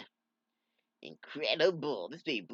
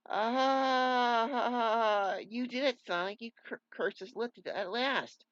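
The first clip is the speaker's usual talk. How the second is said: joyful cry; 11 semitones higher, 6 dB louder, much slower than usual. Acted. Grateful cry of relief.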